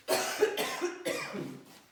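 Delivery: dry dusty coughing; real cough from sawdust; coughing on dust. Real, not acted. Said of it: "I'm already coughing from the dust".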